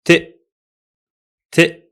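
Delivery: a lone crisp "t"; isolated t sound. Shown as a demonstration. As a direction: say "t" on its own, twice. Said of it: "The first one sounds like".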